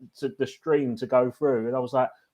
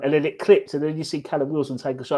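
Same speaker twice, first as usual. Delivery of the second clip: usual speaking voice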